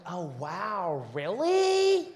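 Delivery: put-on high, drawn-out valley girl voice; ditzy American tourist asking Africa a question. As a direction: play the clueless girl, slow and sing-song. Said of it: "She's like".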